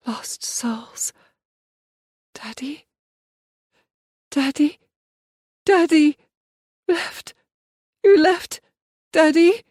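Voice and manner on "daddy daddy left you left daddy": calling out. Acted. Abandoned girl calling out for her daddy. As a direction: call for him as if he might hear.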